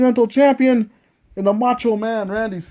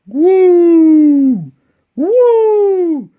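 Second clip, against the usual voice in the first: shrieking jackpot winner; 3 semitones higher, 4 dB louder than usual. Acted. Flair woos again.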